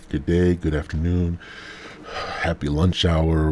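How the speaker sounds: normal talking voice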